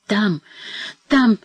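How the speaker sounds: stammering in terror. A narrator's reading. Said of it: "заикалась старуха, как безумная".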